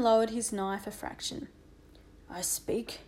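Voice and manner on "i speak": low, soft grunt; guttural, quiet grunted words. A narrator's reading. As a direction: grunt it out low and under the breath. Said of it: "he grunted".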